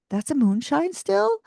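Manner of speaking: can't believe what she's seeing; disbelief. Retold or imitated. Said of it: "And I'm literally like".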